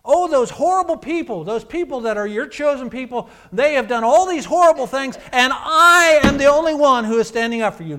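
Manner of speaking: mock Elijah feeling sorry for himself; a hammed-up self-pitying prophet, moaning; whiny self-pity. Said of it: "the tone of it is?".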